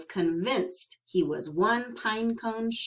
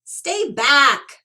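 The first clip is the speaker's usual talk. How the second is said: higher and much louder warning shout; 6 semitones higher, 11 dB louder than usual. Acted. Bruce shouting at Sorrel to keep off. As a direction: bark him away. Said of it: "shouted Bruce".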